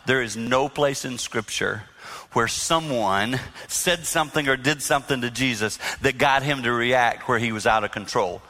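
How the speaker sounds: normal talking voice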